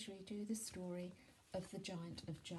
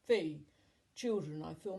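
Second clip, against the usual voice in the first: loud, booming giant chant; one loud, falling giant call; hungry giant; 9 dB louder than usual. A narrator's reading. Giant chanting before a meal.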